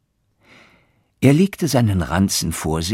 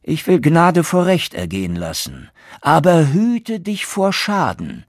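higher, louder stern warning; stern journeyman; 10 semitones higher, 5 dB louder than usual. Acted. Journeyman sparing the innkeeper with a stern warning. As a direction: grant mercy but warn firmly. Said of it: "sprach der Geselle".

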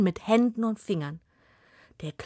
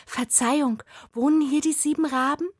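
put-on higher polite girl's question; girl asking; 8 semitones higher than usual. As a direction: ask politely as the young girl. Girl asks timidly at door.

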